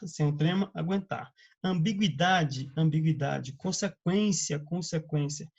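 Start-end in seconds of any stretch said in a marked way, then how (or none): 1.6-2.7 "Ambiguidade" said slowly, syllable by syllable; slow careful pronunciation. Shown as a demonstration. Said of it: "Perceba que a pronúncia não mudou?". 3.5-4.6 "Consequência" said slowly and distinctly; slow careful pronunciation. Shown as a demonstration. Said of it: "Perceba que a pronúncia não mudou?".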